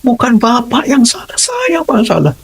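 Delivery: imitating a crying student; tearful confession. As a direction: plead like a sobbing boy.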